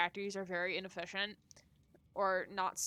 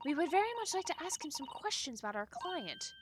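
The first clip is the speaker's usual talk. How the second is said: higher, formal request in character; prim assistant, pitched up; polite assistant character; 8 semitones higher, 3 dB quieter, faster than usual. Acted. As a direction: ask courteously in a lightly put-on higher voice. Voices a polite game character.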